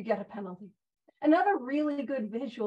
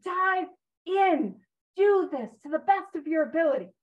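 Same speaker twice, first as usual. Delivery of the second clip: firm rallying plea; emphatic urging; 3 semitones higher, 3 dB louder than usual. Acted. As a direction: urge them to commit fully. Voices the book's urging.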